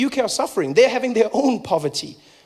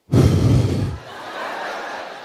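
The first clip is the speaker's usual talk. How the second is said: hard breath out. Retold or imitated. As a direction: huff it out in silent protest. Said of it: "just breathe out really hard".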